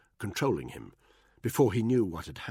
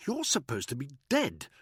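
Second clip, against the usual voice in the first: high, sharp snap; snapped; 8 semitones higher than usual. A narrator's reading. Buckingham snapping at Danny, high and sharp. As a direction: snap it back in a raised voice. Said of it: "Buckingham snapped".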